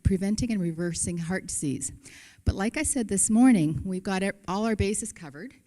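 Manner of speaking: ordinary delivery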